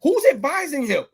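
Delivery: high disbelieving rant about the campaign; exasperated high rant. Real, not acted. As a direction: throw your hands up at the advice.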